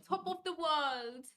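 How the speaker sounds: drawn-out, loud English lilt; English-accented, stretched out. Real, not acted. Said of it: "Were you channeling England".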